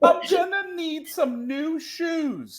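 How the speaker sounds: long, slow, high comic wail; imitated crying. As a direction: drag it out in mock sobs. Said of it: "and he starts crying".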